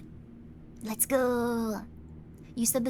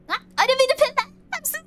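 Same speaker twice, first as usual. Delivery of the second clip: high, loud, fast yelp of alarm; flustered outburst; 13 semitones higher, 9 dB louder, faster than usual. Real, not acted. Streamer reacting to an accidental pin, real flustered cry. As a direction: blurt it out in a sudden panic.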